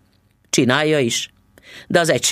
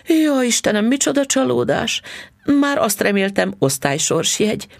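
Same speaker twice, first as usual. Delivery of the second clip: high-pitched lament, drawn from the story; wife's high, let-down exclamation; acted disappointment; 11 semitones higher than usual. A narrator's reading. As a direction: exclaim in mild mock dismay. Wife acts disappointed at the ticket.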